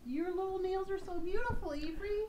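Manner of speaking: put-on high cooing baby talk. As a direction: coo at the little one. Cooing at Avery.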